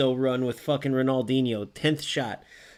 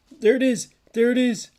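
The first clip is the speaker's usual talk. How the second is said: much higher and louder, triumphant yell; celebrating shout; 10 semitones higher, 7 dB louder than usual. Real, not acted. Streamer yelling in triumph as his team scores. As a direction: shout it out as the ball goes in.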